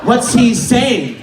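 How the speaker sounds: slow, loud outraged questioning; hammed-up indignant demand; mock accusation. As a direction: punch out each word as an accusation. Girl's accusing response acted out.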